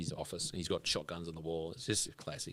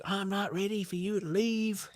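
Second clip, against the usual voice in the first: much higher, slower Southern-style voice for a host saying goodbye; host sadly seeing guests off, in a put-on high voice; imitating Will; 14 semitones higher, slower than usual. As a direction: put on Will's voice, high and slow. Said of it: "he goes".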